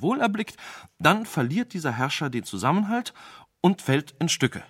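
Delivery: second sage nervously telling his tale to the displeased king. Acted. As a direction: speak fast, sensing your danger. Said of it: "der schon merkte, wie schlecht es um ihn stand".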